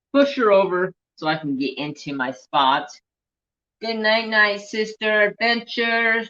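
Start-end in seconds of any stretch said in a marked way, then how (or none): 3.8-6.2 higher sing-song goodnight to the cat; higher, tender goodnight to a pet. Real, not acted. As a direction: coo it up high like talking to a pet.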